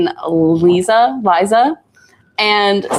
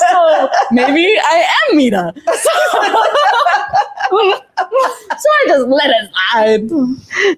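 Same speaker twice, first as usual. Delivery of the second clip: laughing; 8 semitones higher, slower than usual. Retold or imitated. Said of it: "'cause I'm like".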